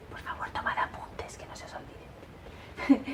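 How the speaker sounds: hushed, unvoiced reminder; whispered aside. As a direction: whisper it like a secret tip to the class. Whispered aside reminding students to take notes. (susurrando un aviso)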